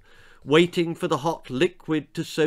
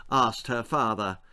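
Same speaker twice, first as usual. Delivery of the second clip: ordinary delivery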